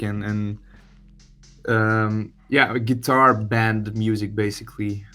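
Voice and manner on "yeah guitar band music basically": ordinary talk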